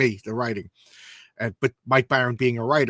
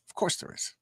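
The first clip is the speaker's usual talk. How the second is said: hushed aside; 10 dB quieter than usual. Acted. Sly aside after comic reveal.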